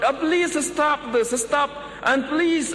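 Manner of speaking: high, drawn-out pleading mock yell; storyteller acting out desperate calls. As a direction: beg them to stop, slowly and urgently. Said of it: "the friends start yelling".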